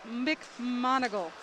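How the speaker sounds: McMonigal drawn out slowly, syllable by syllable; careful name correction. Real, not acted. Announcer retrying a swimmer's surname, McMonigal said slowly and carefully. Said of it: "I'm sorry".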